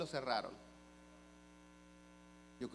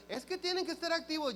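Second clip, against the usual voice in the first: mimicking an official in a much higher voice; high-pitched mock insistence; nagging official; 14 semitones higher, 3 dB louder, faster than usual. Official objects to the customer's papers, mock insistent.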